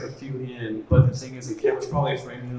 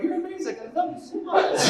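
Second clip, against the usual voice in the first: much higher, laughing gush of praise; high, giddy adoring compliment. Retold or imitated. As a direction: swoon over him, laughing. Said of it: "I'm like".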